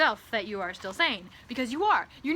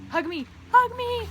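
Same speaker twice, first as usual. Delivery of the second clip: much higher and louder, squealing hug me; ecstatic squeal; 13 semitones higher, 8 dB louder than usual. Pretend. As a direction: squeal it out in delight. Begging new friend for hug.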